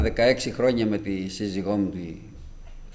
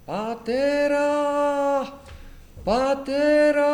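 calling out Father; 4 dB louder, much slower than usual. Retold or imitated. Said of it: "Άρχισα να φωνάζω".

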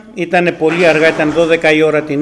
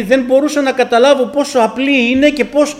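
amazed retelling; 8 semitones higher, 4 dB louder than usual. Retold or imitated. Mother amazed telling son.

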